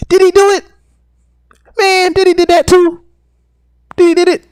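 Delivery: high squeaky mock voice; high teasing cartoon voice; mock catchphrase. Repeating the joke catchphrase.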